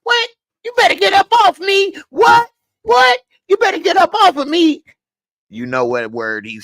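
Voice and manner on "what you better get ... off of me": way higher, fast mock snap; mock snapping. Character snapping at Darnell who grabbed her, mock angry snap. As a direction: fire it back fast and high, hammed-up. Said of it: "when he snapped".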